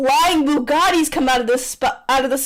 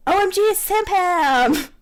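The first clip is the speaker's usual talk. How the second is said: higher, airheaded squeal; dumb exclamation; 6 semitones higher than usual. Acted. [name] exclaiming like a clueless fan, high. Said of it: "[name] exclaims, being a dumbass".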